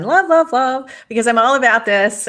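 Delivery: high and loud, bursting with delight; gushing excitement. Real, not acted. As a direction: gush love love love like you can't hold it in. Gushing over a favourite question.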